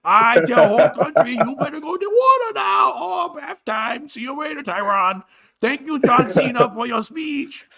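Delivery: put-on high-pitched caricatured Chinese accent; hammed-up speech in a fake Chinese accent; mock Chinese accent. Fake prime minister addressing the whole country, mock Chinese accent. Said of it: "it was the Prime Minister of China".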